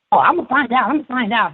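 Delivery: high-pitched mock determination; insistent assistant. Assistant answering a boy who won't tell, insistent high voice.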